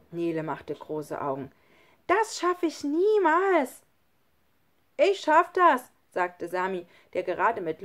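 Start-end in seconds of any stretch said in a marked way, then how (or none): 2.1-3.8 high, loud, drawn-out protest; dismayed exclamation. A narrator's reading. Daunted child in story dialogue. 5.0-5.9 put-on high, loud child's boast; confident exclamation. A narrator's reading. Confident child in story dialogue.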